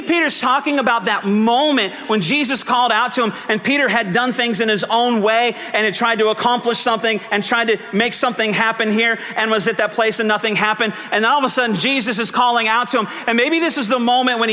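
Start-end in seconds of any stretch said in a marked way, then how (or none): none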